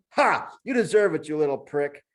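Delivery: high, loud gloating monkey threats; threatening monkey. Acted. Monkey threatens Julian from the floor, loud and high. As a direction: promise revenge with relish.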